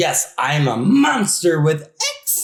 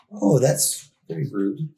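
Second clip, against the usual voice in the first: ordinary delivery, nothing marked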